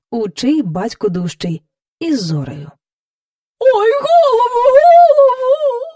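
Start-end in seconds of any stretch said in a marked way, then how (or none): 3.7-5.8 very high, loud cow wail; pleading wail. A narrator's reading. Cow begs as courtiers pull the star, wailing. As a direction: wail it, stretching the last word. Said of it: "благала корова".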